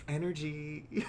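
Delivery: slow, breathy mock of spiritual types; teasing airy energy talk. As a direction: drawl it like a dreamy hippie. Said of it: "Sometimes I will hear people talking about, like".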